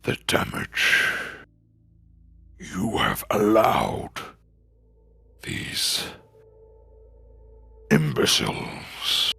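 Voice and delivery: low menacing demon rasp; deep growling voice. Acted. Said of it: "a deep growling voice".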